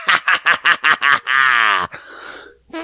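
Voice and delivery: staccato put-on ha-ha laugh; mock gloating laugh. Laughing at the buddy who flipped you off, mock gloating laugh. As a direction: hammer out a fake laugh.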